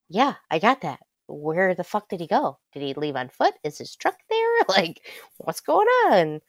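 retold frantic confusion. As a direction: demand answers, not getting it. Retold frantic questions to mom.

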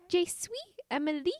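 put-on American-accented beginner French; Emily. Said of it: "that's how I sound".

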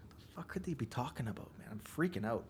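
whispered panicked thought; inner thought whisper. Retold or imitated. Arrested man thinking to himself, whispered panic. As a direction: hush it like a frightened thought in your head. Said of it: "I'm thinking to myself".

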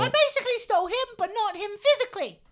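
very high, loud puppet voice; squeaky little red monster; Elmo voice. Acted. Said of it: "we just had Elmo".

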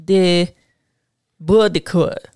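French accent on De Baudricourt. Shown as a demonstration. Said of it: "I'm gonna say it in my best French accent".

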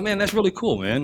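delighted. Retold or imitated. Pleased after praise.

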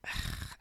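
a long emotional sigh; deep breathy sigh. Real, not acted. To listeners, overcome with gratitude, a long sigh. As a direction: let the breath out, overwhelmed.

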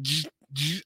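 buzzing electric noise. Retold or imitated. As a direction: mimic a zapping buzz. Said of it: "he's like".

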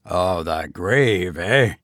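low, slow knowing goblin; mocking goblin. A narrator's reading. Goblin doubting the sexton's excuse, low and mocking. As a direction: draw it out deep and sceptical.

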